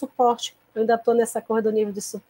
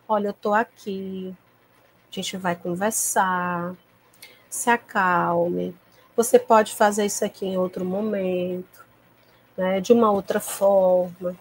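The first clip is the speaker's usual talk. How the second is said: calm soothing; 3 semitones lower than usual. Retold or imitated. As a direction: talk the child down, unhurried and gentle. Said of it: "transmitindo pra ele a calma, a tranquilidade".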